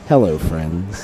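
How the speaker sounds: slow, low broadcaster greeting; hushed, slow golf host greeting; Jim Nantz. Retold or imitated. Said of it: "a little bit of Jim Nance in my style".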